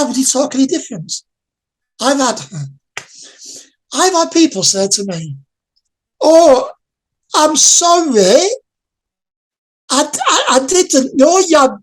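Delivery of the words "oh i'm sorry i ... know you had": suddenly changed voice, higher and drawn-out pity; mock sympathetic voice. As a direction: imitate gushing, over-sorry sympathy, pitched up. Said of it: "why was your voice suddenly changed".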